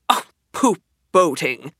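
scornful, dismissive pooh-poohing; great disgust. Acted. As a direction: sniff at boating as beneath him. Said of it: "said Toad in great disgust".

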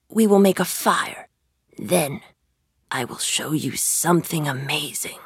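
quiet, sinking to a whisper. A narrator's reading. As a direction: lure him in with a secret. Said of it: "said the magician".

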